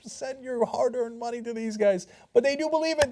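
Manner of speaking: ordinary talk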